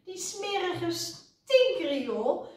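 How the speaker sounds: high, slow, drawn-out disgust; high-pitched cross complaint; disgusted snap. A narrator's reading. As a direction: stretch out each word in revulsion. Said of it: "snauwde Suzy".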